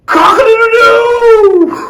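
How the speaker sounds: very high, loud, slow cock-a-doodle-doo; rooster crow. Retold or imitated. The rooster crowing at dawn, loud and high. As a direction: crow like a rooster at full volume. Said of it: "A rooster crowed".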